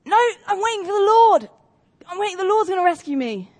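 high, loud stubborn refusal; stubborn shout. Retold or imitated. Man on the cliff shouts to the rescue boat, stubborn loud refusal. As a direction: yell back that the Lord will save him. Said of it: "And he goes".

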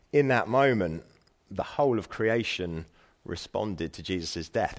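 ordinary delivery

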